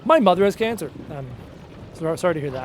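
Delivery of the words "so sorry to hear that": ordinary delivery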